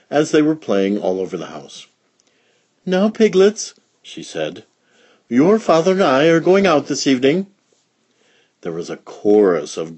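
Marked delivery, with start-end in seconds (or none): 2.8-3.7 high, bright motherly voice; fussy mother pig, higher and brighter. Acted. 5.3-7.5 high, bright motherly voice; fussy mother pig, higher and brighter. Acted.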